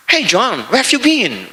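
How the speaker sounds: casual English greeting like a native Englishman; English native accent. Shown as a demonstration. Preacher showing Indonesians sound English, in native English accent. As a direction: toss it off like a Londoner. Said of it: "Bicara Inggris kayak orang Inggris".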